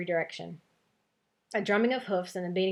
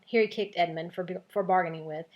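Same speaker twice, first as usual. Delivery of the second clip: usual speaking voice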